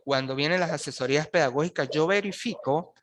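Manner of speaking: usual speaking voice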